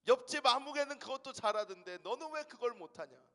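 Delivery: nagging parent. Retold or imitated.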